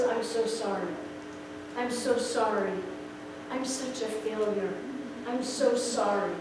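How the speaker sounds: low, soft retelling of a sobbing apology; understated remorse, voice dropped low; broken apology. Retold or imitated. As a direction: quietly imitate a broken man's repeated apology. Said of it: "he started howling".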